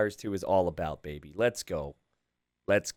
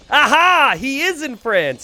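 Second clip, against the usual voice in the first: much higher and louder, slow and drawn out; triumphant aha; 12 semitones higher, 8 dB louder, slower than usual. Real, not acted. Commentator gleeful the player is from France, triumphant. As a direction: savour the reveal like a win.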